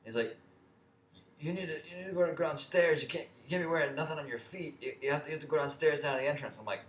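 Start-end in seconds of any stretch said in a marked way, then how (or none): 1.4-6.6 higher put-on bossy guard, speeding up; hammed-up guard laying down the rules; mock guard voice. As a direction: imitate a pushy security guard giving orders. Guard's order retold in voice.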